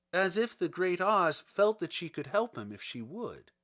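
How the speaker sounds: ordinary delivery, nothing marked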